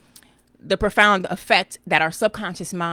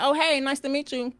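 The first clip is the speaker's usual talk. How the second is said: mock polite greeting; 7 semitones higher than usual. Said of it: "I'm like".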